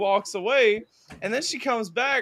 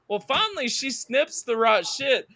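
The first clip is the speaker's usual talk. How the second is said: ordinary delivery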